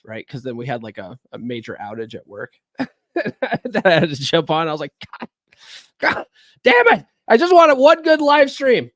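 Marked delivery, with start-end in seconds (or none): 5.2-8.9 loud, high, exasperated groan of a curse; mock frustrated outburst. Streamer retelling his reaction to a work outage, mock exasperated outburst. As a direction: act out the frustration of a ruined stream.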